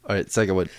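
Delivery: usual speaking voice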